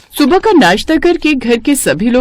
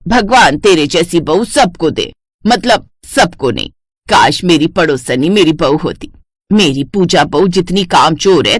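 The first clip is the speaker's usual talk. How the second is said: put-on lower, older mother-in-law voice, sweetly fond; 4 semitones lower than usual. Mother-in-law fussing over the neighbour's halwa, lower voice. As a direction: play the doting mother-in-law, a lower aged voice.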